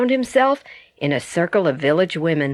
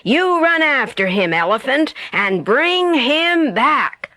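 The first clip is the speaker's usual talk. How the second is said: leopard ordering; 6 semitones higher than usual. A narrator's reading.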